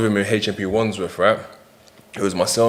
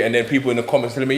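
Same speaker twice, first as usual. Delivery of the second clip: ordinary delivery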